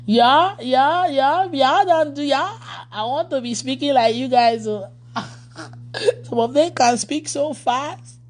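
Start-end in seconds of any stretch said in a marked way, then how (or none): 0.1-2.6 light mock Liberian "yeah, yeah", higher; Liberian accent. Pastor teasing Liberian followers, mimicking their accent. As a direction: playfully imitate the Liberian yeah yeah. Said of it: "your accent".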